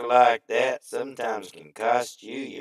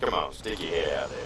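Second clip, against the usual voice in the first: usual speaking voice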